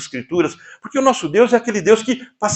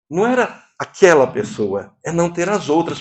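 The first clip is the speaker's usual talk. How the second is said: normal talking voice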